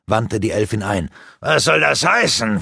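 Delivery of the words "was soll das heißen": mild snap. Acted. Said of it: "fuhr Rurak sie an".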